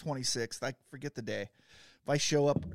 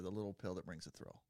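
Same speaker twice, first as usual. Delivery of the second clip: low, much softer throwaway repeat; muttered slogan; 9 semitones lower, 13 dB quieter than usual. Pretend. Rethinking the joke, mumbling the slogan softly.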